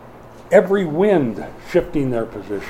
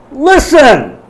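sharp, much higher and louder call to attention; shouting at class; 11 semitones higher, 9 dB louder than usual. Retold or imitated. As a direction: snap the sleepy students awake. Teacher waking sleepy students.

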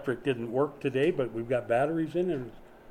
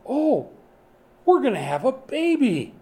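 excited; 9 semitones higher, 5 dB louder than usual. Retold or imitated. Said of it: "and you say".

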